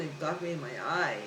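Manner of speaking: ordinary talk, nothing marked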